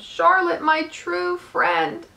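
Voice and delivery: high, drawn-out wail of grief; moaning. A narrator's reading. Wilbur sobbing over his dying friend, moaning high and slow. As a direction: moan it slowly, high with anguish. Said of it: "he moaned".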